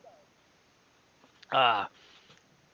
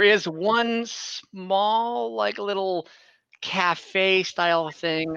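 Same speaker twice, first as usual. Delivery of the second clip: cute little cafe; 6 semitones higher, much slower than usual. Acted.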